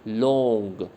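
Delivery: लॉन्ग held long on the "o", falling; vowel demo. Shown as a demonstration. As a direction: stretch लॉन्ग so the vowel stands out. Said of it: "कौन से vowel की sound आ रही है".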